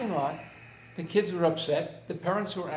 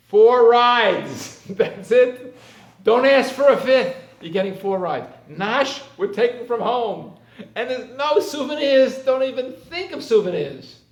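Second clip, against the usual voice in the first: loud firm father laying down the rules with a laugh; stern announcement; 6 semitones higher, 11 dB louder, slower than usual. Retold or imitated. Father to kids before the holiday, loud firm announcement. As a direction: lay down the law to the kids, half laughing. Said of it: "But this time I said".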